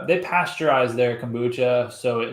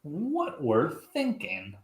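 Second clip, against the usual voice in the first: slow, softer echo of the segment title; drawn-out echo; 7 dB quieter, much slower than usual. Acted. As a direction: stretch out each word, softly. Co-host echoes the segment title.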